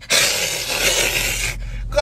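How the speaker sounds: long voiceless mock yowl of a sore loser; imitated wet-cat noise; cat-like hiss. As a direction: screech like a cat dropped in water. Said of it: "like a cat fell in a bathtub".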